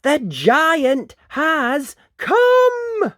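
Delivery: very slow, high, loud announcement; word-by-word cry of alarm; yelling. Acted. Missus Jones announcing the giant, yelling. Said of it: "Missus Jones yelled".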